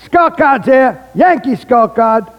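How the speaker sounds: high-pitched scorecard hawker's call; stadium vendor's cry. Retold or imitated. Guy selling scorecards at Yankee Stadium, imitated hawking cry. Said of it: "this guy who used to go".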